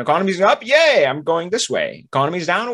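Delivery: mock cheer. Acting out riding the economy.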